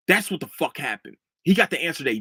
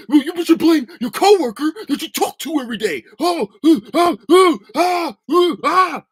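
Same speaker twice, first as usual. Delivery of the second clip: high, loud whiny stammering mockery; mocking coworker; 13 semitones higher, 9 dB louder than usual. Mocking a coworker who blames him, high and whiny. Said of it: "that's literally what he's doing".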